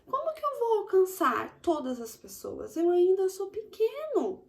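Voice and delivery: put-on high, small child asking. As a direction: play a little kid asking shyly. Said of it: "você pergunta". (voz aguda de criança pequena)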